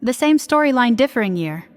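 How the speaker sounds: usual speaking voice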